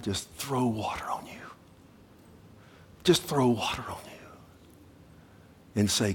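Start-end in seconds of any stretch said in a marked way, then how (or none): 0.0-4.1 hushed unvoiced whisper. Real, not acted. Preacher picturing throwing Jordan water on them, whispered. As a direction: breathe it like a secret.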